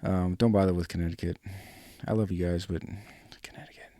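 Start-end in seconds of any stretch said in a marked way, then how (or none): 3.4-3.9 mock whisper, almost silent; whispered aside. Real, not acted. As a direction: whisper it like a joke secret. Joking aside about Connecticut.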